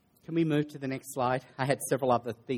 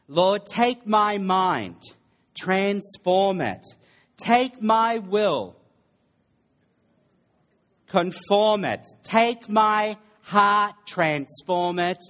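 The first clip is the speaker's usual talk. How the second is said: very slow, raised, drawn-out plea; fervent prayer; 6 semitones higher, 5 dB louder, much slower than usual. Acted. Preacher prays to surrender mind and heart, slow and fervent. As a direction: call out each petition slowly and higher.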